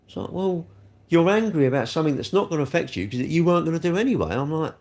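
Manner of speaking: normal talking voice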